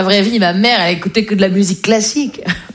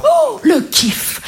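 thrilled; 11 semitones higher, much slower than usual. Real, not acted. Thrilled about the record.

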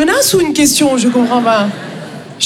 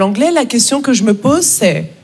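normal talking voice

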